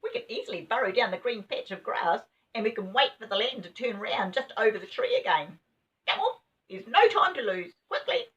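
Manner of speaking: high, urgent little rabbit voice; rising excitement, hurry them along; hurried rabbit. A narrator's reading. Rabbit hurries the children to burrow, high and urgent. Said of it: "rabbit urges them on".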